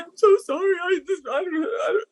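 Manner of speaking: high, pleading mock apology; imitating a helpless, high-pitched apologizer; flustered apology. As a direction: stammer out a frantic, tearful sorry.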